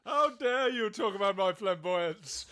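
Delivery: high, loud mock indignation; hammed-up British outrage. Host answering the claim that flamboyance comes from him, mock outrage. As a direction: protest like a grandly offended Brit. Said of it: "There it goes with the British accent".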